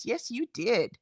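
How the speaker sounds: ordinary talk, nothing marked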